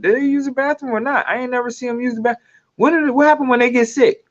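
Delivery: high, rapid-fire puzzled questions; hurried high-pitched wondering; puzzled questioning. Retold or imitated. Acting a puzzled viewer's questions.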